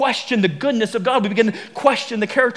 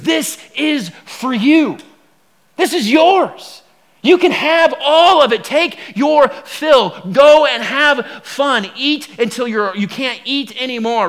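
generous God; 3 semitones higher, 5 dB louder, slower than usual. Acted. God giving the garden to man and woman, slow and lavish. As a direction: hand it all over with open arms.

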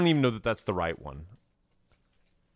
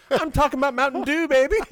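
high, loud gleeful punchline; teen athlete's comeback; 13 semitones higher, 6 dB louder than usual. Retold or imitated. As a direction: belt out the cheeky save. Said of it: "And he goes".

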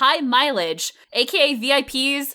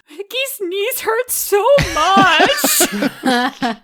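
imitating her own sobbing, much slower and higher, half laughing; mock hysterical crying; 9 semitones higher, 3 dB louder, much slower than usual. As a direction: ham up the pregnant crying, laughing at yourself. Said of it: "I was just crying hysterically".

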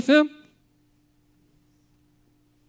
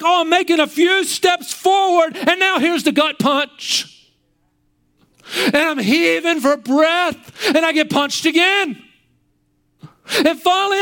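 higher, strained, near crying; anguished cry; 5 semitones higher than usual. Pretend. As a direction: cry out as someone beaten down again and again. Voicing a despairing sufferer.

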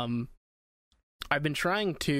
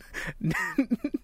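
high laugh; 10 semitones higher than usual. Real, not acted. Laughing between game commands.

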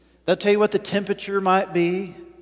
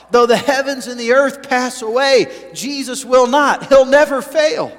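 louder rising declaration; proclaiming scripture; 5 semitones higher, 5 dB louder than usual. Acted. Preacher to congregation, rising proclamation. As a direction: ring it out with conviction.

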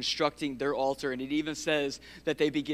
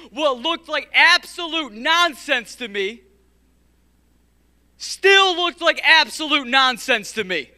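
preaching build; 8 semitones higher, 10 dB louder, slower than usual. Real, not acted. Preaching climax, repeated phrase.

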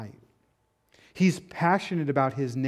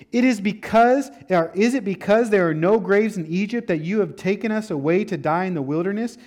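louder, higher complaint; complaining Israelites; 5 semitones higher, 6 dB louder than usual. Acted. Israelites complaining to Moses about dying, raised voice. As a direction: whine at Moses like frightened people.

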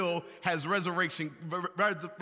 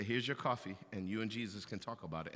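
much softer, lower, quiet rebuke; gentle wife; 8 semitones lower, 10 dB quieter, faster than usual. Retold or imitated. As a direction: remind him kindly where he belongs. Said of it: "And then she'll say".

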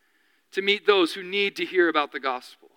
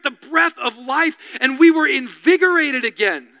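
higher, louder fervent sermon voice; impassioned preaching; 5 semitones higher, 3 dB louder than usual. Acted. Pastor to congregation, rising fervent preaching. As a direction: build the congregation up with fervour.

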